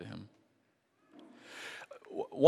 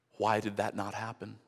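ordinary talk, nothing marked

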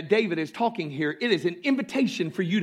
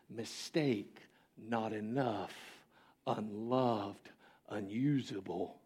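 much softer, lower, hushed; whispered lies; 7 semitones lower, 12 dB quieter, slower than usual. Retold or imitated. Pastor voices the thoughts that attack youth, hushed. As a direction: let each word sink like a quiet lie. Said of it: "thoughts that come that say".

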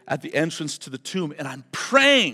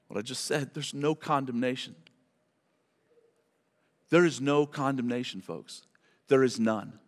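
quiet; 6 semitones lower, 7 dB quieter than usual. Real, not acted. Hushed emphatic restatement.

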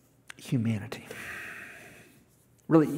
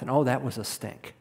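ordinary delivery

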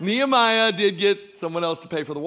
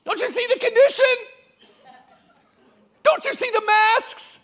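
mock objector; 12 semitones higher than usual. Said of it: "You say".

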